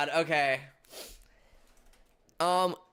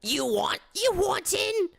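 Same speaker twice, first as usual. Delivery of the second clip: put-on squeaky old lady guard; high old lady voice; 14 semitones higher than usual.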